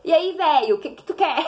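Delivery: laughing fond greeting on the phone to dad; affectionate teasing. Retold or imitated. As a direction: tease dad fondly. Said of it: "muito querido".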